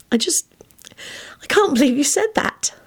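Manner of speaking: high, whispery, overcome voice; mortified whisper. A narrator's reading. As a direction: whisper it, overwhelmed. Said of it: "whispered in a mortified voice".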